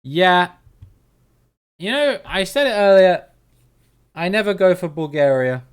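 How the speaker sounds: loud, emphatic declaration; loud outburst. Real, not acted. Telling chat he never picks Bulgaria, loud and emphatic. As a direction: belt it out in triumph. Reacting to the round result.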